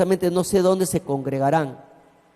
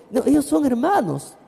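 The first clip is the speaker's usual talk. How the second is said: 1 gossiping neighbour; 7 semitones higher than usual. Retold or imitated.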